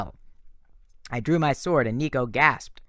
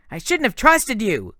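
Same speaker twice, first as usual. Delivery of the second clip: high, loud, cracking with hurt; a boy's shout that breaks into tears; voice breaking; 10 semitones higher, 6 dB louder than usual. A narrator's reading. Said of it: "His voice broke".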